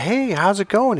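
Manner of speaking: high, lilting mock hello; imitated pickup greeting. Friend hitting on passing girl, imitated chatty greeting. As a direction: imitate a guy hailing a stranger.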